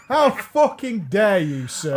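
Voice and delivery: slow, drawn-out pretend indignation; mock outrage. Defending Frankly English to the co-host, mock outrage. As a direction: play the affronted gentleman, half laughing.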